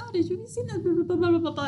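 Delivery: high, light prattling voice; chatty cashier. Retold or imitated. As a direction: mimic a gushing chatterbox in a high voice.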